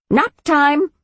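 high, lilting command; high singsong. A narrator's reading. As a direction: sing it out like a spell. Witch casts sleep spell.

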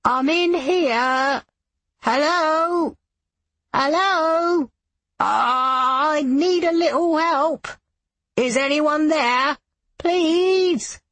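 put-on high, slow, tearful elderly man calling; old man calling for help. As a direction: call out like a frail trapped old man. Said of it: "Jonah as Fred Pink calling".

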